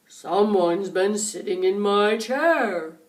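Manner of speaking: deep, slow, booming bear voice; big low papa bear rumble. A narrator's reading. Papa bear finds his chair used, deep and slow.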